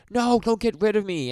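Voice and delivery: high, loud imitation of a panicking child; mock freaking out. Podcaster voices the girl pleading with her father, mock panic. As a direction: wail it in pretend panic. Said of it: "she's freaking out".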